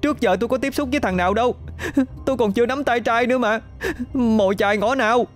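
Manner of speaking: put-on high, fast, tearful girl's protest; blubbering cry. A narrator's reading. Hân crying at the ghost outside her door, tearful. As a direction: wail it out like a sobbing child. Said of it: "Hân òa khóc".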